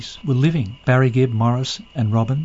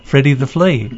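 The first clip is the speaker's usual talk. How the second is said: normal talking voice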